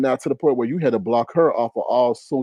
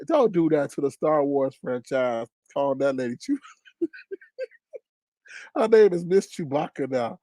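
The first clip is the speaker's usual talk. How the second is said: ordinary delivery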